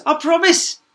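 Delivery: very high, loud eager princess; hammed-up princess vow; princess promising. A narrator's reading. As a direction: promise brightly without meaning it. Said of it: "said the princess".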